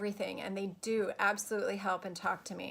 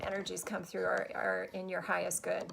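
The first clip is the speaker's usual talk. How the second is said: ordinary talk, nothing marked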